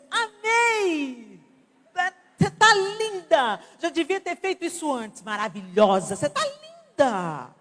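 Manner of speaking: high, over-the-top fake praise; fake gushing hypocrite. Hypocrite friend gushes to the friend with a bad haircut, high fake delight. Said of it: "A hipócrita".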